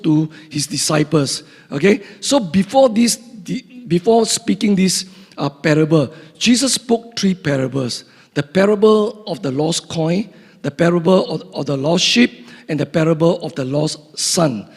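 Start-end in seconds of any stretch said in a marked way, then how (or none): none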